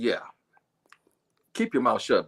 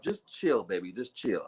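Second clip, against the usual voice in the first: soothing calm-down; 9 semitones lower, 4 dB quieter than usual. Retold or imitated. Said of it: "I said".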